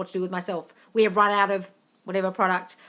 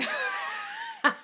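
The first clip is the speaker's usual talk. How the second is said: a squealing laugh; shrieking giggle at the joke; high burst of laughter; 6 dB quieter than usual. Real, not acted.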